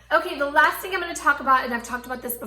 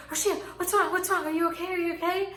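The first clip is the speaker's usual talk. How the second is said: higher-pitched, concerned husband voice; alarmed questions, pitched up; worried husband; 7 semitones higher, faster than usual. Retold or imitated. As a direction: imitate a husband checking on his crying wife. Husband wakes to her crying.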